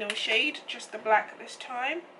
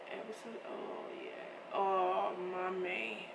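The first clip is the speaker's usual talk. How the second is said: awed; 2 semitones lower, 8 dB quieter, slower than usual. Real, not acted. Admiring the glitter pigment.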